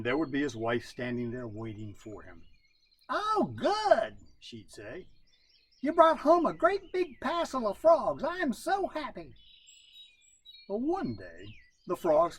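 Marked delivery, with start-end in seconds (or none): 3.1-4.2 high, bright-pitched wife voice; a happy wife greeting her husband; pleased wife. Acted. Wife greeting raccoon husband at the door, high and pleased. 5.8-9.3 high, bright-pitched wife voice; a happy wife greeting her husband; pleased wife. Acted. Wife greeting raccoon husband at the door, high and pleased.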